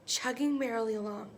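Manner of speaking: plain reading voice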